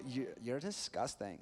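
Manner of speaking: mock Valley Girl disgust; lightly put-on Valley Girl accent. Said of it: "Valley Girl straight away".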